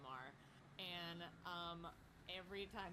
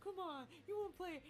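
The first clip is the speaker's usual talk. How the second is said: high, lightly voiced teasing friend; 11 semitones higher, 4 dB louder than usual. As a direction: coax him to play in a mock higher voice. Friend teasing him to play.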